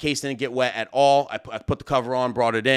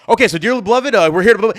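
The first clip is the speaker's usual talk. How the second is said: mimicking a priest gabbling the vows, higher and louder; rushed priest; 6 semitones higher, 7 dB louder, faster than usual. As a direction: hurry through the vows like a flustered priest. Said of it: "he started talking all fast and rushing it".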